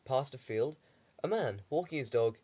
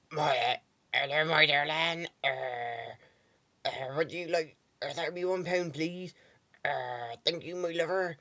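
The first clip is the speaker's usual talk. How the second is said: higher West Country voice, a smoker's forty-a-day rasp; West Country shop lady; 4 semitones higher than usual. Retold or imitated. Vlogger imitating a Bath shopkeeper, West Country accent. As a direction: imitate the West Country shopkeeper calling you her lover. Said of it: "She had a forty a day voice mixed in with a West Country accent".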